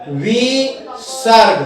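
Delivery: विसर्ग slowly split as "vi... sarg"; drawn-out syllables. Shown as a demonstration. Teacher introducing a new Sanskrit term, slow and syllabic. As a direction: stretch विसर्ग into two long, separate syllables.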